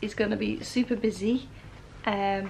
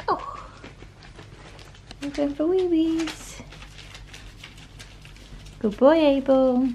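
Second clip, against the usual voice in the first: puppy talk; 7 semitones higher, 3 dB quieter than usual. Real, not acted. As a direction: coo at the pups like tiny babies. Morning greeting to the puppies.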